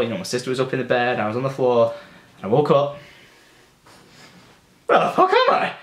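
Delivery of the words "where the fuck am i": high startled yell of confusion; a panicked squeak, no idea where you are; shocked waking. Retold or imitated. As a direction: blurt it out, suddenly lost. Waking up confused in dad's bed.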